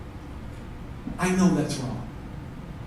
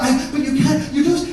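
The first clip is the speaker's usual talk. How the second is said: high, faltering protest; flustered wife; 8 semitones higher than usual. Retold or imitated. Wife protesting to Michael as they flee, high and flustered. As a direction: imitate her too rattled to finish.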